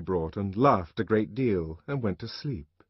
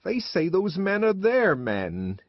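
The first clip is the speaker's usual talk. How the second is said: high, slow tearful complaint; tearful woman; 8 semitones higher, slower than usual. A narrator's reading. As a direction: complain through tears as if to yourself. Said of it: "Linda was crying too".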